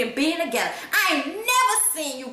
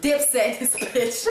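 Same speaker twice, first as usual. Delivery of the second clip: ordinary talk, nothing marked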